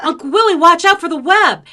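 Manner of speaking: loud, high-pitched warning; acting out a child's alarmed shout; urgent warning shout. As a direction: yell ahead in rising panic. Retold louder repeat of warning.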